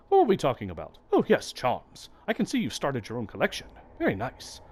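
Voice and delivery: lightly hammed-up matronly charm seller; voiced charm seller. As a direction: coo at the visitor like a doting grandma. Voicing Salubra's dialogue.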